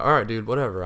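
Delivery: mock-indignant outburst at being hung up on; retold annoyance. Caller retorts to the seller who hung up, annoyed. Snaps back after scammer hangs up.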